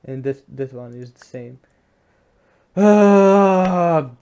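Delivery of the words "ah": frustrated shout. Real, not acted. Game frustration.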